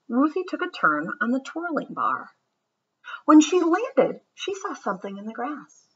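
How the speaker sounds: lively, sing-song storybook read-aloud; reading to children. A narrator's reading. Teacher reads a picture book to her class, lively and sing-song. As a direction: read it like story time for kids.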